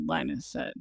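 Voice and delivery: ordinary delivery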